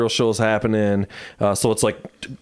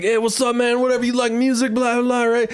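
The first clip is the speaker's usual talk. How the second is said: mimicking the rapper; 11 semitones higher than usual. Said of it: "he's like".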